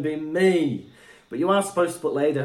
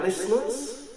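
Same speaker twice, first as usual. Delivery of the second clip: echo effect; 10 semitones higher, slower than usual. Shown as a demonstration. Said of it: "talk like that. echo".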